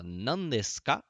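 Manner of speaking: very slow, each syllable clear; slow model phrase. Shown as a demonstration. Teacher slowly modelling the question for students. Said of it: "você fala".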